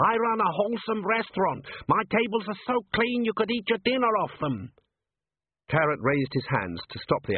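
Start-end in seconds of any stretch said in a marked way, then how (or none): 0.1-4.7 indignant high-pitched dwarf restaurateur; Gimlet. Acted. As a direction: protest in a high voice, wounded pride.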